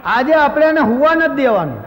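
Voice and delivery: loud, triumphant mock vow; gleeful revenge shout. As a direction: shout it like you're plotting sweet revenge. Neighbour plotting revenge, loud.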